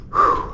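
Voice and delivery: a high puffing whew; high breathy whew. Real, not acted. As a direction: blow out a high winded whew. Exhale after climbing steep stairs.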